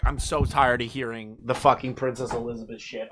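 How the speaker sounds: normal talking voice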